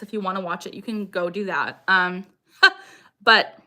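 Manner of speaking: ordinary delivery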